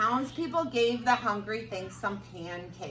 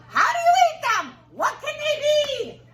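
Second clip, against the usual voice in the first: very high, loud squeaky wonder; puzzled townspeople; 11 dB louder than usual. Acted. Townspeople baffled by the strange food, very high and loud.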